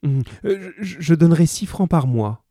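hesitate, then offer anxiously; hesitant mother. A narrator's reading.

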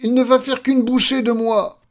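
high, loud cries of horror; panicked scream. Acted. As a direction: shriek as if facing an ogre. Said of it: "hurla-t-il". (cris aigus d'épouvante)

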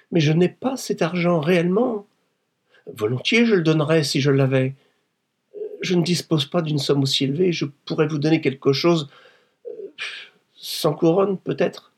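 high-pitched, anxious protest; desperate pleading. Acted. Wife to her blackmailer over money, pleading anxiously.

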